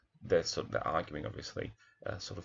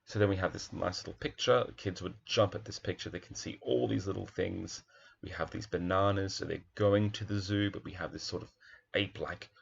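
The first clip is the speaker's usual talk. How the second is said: ordinary talk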